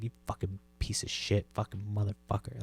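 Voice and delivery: soft muttered cursing; under the breath. Retold or imitated. Cursing the parked lady under the breath. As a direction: spit the insults quietly to yourself. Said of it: "just under my breath".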